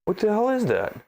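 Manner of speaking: exasperated disbelief. Real, not acted. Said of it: "I'm getting worked up for no reason".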